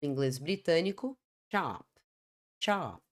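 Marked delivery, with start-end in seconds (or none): 1.5-3.0 American "chop" with an open "ah" vowel; American English chop, open vowel; American pronunciation. Shown as a demonstration. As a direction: say chop as "chahp". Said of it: "Tom mais aberto, inglês americano".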